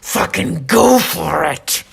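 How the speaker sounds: put-on loud, drawn-out drunken egging on; drunk side. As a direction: holler it like the drunk devil on your shoulder. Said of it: "That's the drunk side of me talking".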